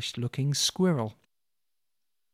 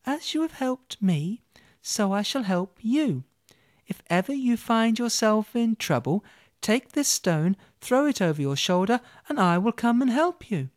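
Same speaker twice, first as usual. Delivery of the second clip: tiny mouse voice; 7 semitones higher than usual. Acted. Field mouse promising help to Olga, high and small.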